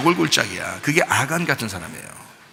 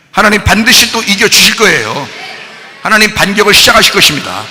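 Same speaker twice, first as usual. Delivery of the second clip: booming, high-pitched proclamation; full-force preaching; 7 semitones higher, 10 dB louder than usual. Real, not acted. Preacher promising the congregation that God will win, shouting.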